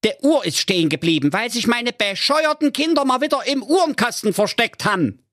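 very high, fast squeaky mother; mother grumbling about her kids in a cartoon squeak; Mother Keule. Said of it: "die Ingeborg Keule".

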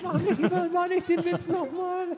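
high, slow whiny plea; pleading angel voice. Acted. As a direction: whine it like a tiny angel.

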